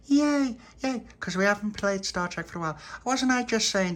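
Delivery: very high loud cheer; excited cheer. Acted. Charlotte cheering about playing Star Trek, high loud squeal. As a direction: squeal it like a thrilled little girl.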